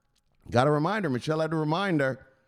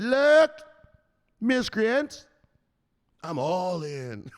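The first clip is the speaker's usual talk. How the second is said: mock bold gambler; 7 semitones higher, much slower than usual. Streamer voices Lorna going all in at the poker table. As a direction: push all in like a fearless player. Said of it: "and she said".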